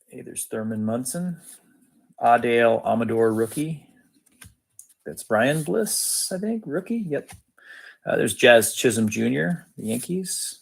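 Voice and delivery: ordinary delivery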